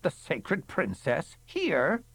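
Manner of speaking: slow, doubting, rising to a high squeak; a fussy, disbelieving question; querulous. Acted. A guest questioning in a querulous voice. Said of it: "a querulous voice inquired".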